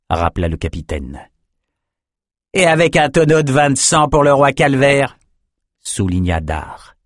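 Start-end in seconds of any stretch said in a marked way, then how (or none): 2.5-5.2 higher, lively added remark; renchérit avec entrain. A narrator's reading. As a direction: chime in with relish, pitched up. Dar souligne, renchérit. (renchérir avec entrain, plus haut)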